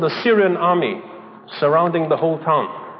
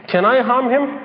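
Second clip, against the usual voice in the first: mock Satan asking; 7 semitones higher than usual. Satan asking God for permission.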